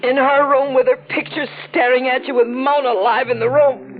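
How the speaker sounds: high-pitched, incredulous reproach; wounded indignation. Acted. Rejected woman confronting Stitch in Mona's room, high wounded indignation.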